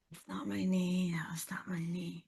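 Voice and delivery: whispered through the sting of a bumped knee; pained whisper. Real, not acted. Hurt guest whispering to her cohost. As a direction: hush it out, wincing.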